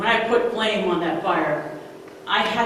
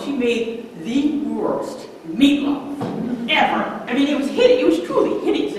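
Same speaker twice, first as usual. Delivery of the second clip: mock horror; 5 semitones higher, 4 dB louder than usual. Mother's horrible meatloaf recalled.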